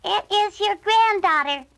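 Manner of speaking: wolf mimicking a little girl; wolf faking the girl. A narrator's reading. Wolf at the door pretending to be the granddaughter. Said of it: "said the wolf".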